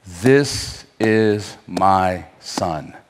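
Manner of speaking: low, very slow divine proclamation; a solemn heavenly voice, drawn out. Acted. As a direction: let it fall low and slow like God speaking. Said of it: "And the voice says".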